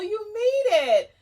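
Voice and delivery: high, loud, eager little owl; puppet owl. Acted. Owl puppet greeting the teacher, high and eager. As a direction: greet like a thrilled puppet friend.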